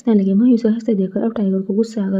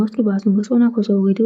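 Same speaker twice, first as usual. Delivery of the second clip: usual speaking voice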